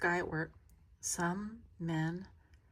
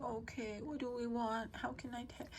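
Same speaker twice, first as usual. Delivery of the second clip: put-on soft, higher voice for a sick child; soft baby talk; 6 semitones higher, 7 dB quieter than usual. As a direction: gently coo to a sick little one, quietly. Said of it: "two ways I talk like that".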